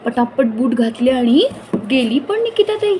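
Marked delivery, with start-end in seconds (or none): 1.9-3.0 Nikita pitched much higher in amazement; amazed emphasis. A narrator's reading. Narrator marvels to children how fast Nikita left. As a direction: lift Nikita high with wonder. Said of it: "बघा तर".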